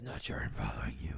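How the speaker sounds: very low, whispered Batman voice; hushed, voiceless Dark Knight take. Acted. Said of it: "much more Dark Knight with your John".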